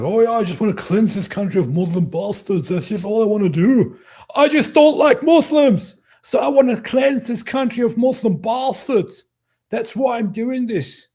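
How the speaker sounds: high, loud, hammed-up zealot; sarcastic caricature of a raving bigot; mock ranting attacker. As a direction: put on a strained, high-pitched mock rant. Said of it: "worst Scottish accent since Mel Gibson".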